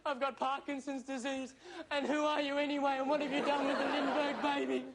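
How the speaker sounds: ordinary delivery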